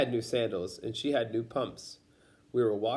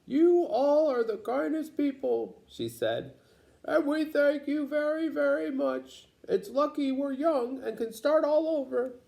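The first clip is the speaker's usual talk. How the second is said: put-on high, slow old woman's voice, grateful; grandma's speech. Grandma thanking the neighbors, high old-lady voice. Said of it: "my grandma made a speech".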